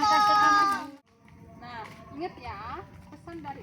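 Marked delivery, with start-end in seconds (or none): 0.0-0.9 loud cheer. Shown as a demonstration. Teacher prompts children to thank loudly.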